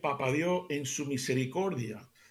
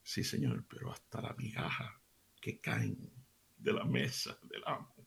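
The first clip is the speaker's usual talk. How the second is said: pleading mother's low whisper; humble whisper; 7 semitones lower, 10 dB quieter than usual. Retold or imitated. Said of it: "aquella madre le dijo".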